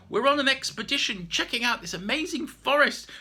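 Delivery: put-on high, loud eager boy; bursting with adventure; excited boy. Peter excited on expedition.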